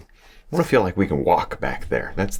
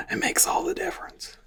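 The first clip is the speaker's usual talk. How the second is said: whisper; 5 dB quieter than usual. Real, not acted. Emphatic aside to viewers.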